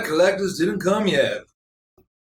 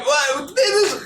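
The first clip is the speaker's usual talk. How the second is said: indignant NPC; 11 semitones higher, slower than usual. Acted. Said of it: "He looks at you like".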